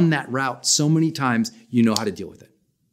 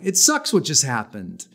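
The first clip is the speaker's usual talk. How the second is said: normal talking voice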